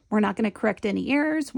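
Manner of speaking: ordinary talk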